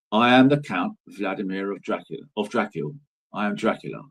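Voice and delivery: low, soft, measured count; lightly voiced calm aristocrat; calm aristocratic Dracula. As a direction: announce yourself calmly as a noble vampire. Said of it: "very calmly".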